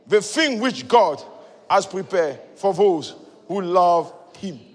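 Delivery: slow, declaimed verse reading; scripture reading. Real, not acted. Preacher reading the verse with the congregation, solemn declamation. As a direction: read it out slowly, phrase by phrase.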